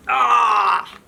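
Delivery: a sharp "ah!" as he runs to kick; mock cry of effort; short effort cry. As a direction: cry out mid-run. Charlie Brown kicking.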